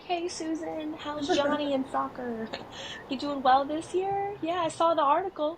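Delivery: high, polite school-mom chitchat; put-on small talk. Imitating herself greeting a mom at drop-off, high fake-friendly. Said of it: "I'll be like".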